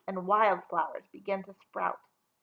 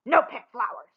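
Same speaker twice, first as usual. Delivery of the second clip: snarling; 2 semitones higher, 5 dB louder than usual. A narrator's reading.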